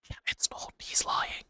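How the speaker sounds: whispered, very soft aside; sneaky whisper. Acted. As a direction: breathe it like a hidden voice in the room. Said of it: "Who said that?".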